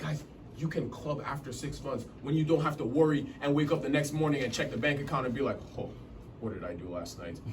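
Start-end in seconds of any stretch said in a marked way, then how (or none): 5.8-7.4 hungover regret. Retold or imitated. Hungover morning after voiced.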